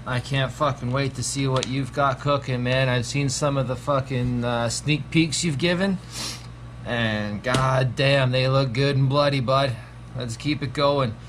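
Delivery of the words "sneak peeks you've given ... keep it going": ordinary talk, nothing marked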